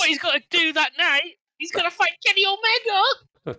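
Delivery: high, loud and worked up; excited. Real, not acted. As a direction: get fired up and call it out. Mocking wrestling booking excitedly.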